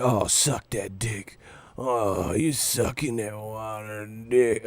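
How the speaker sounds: slow, drawn-out mock moaning; mock lustful fan. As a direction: draw it out like an overexcited, lusting fan. Mocks fans lusting over boxer.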